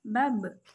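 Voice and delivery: "be" ending with a bounced, echoing b; bouncing sound. Shown as a demonstration. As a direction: let the dead ba bounce off the lips. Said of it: "suaranya terdengar memantul".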